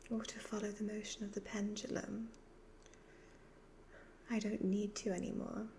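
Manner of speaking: usual speaking voice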